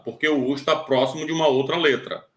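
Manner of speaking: ordinary talk, nothing marked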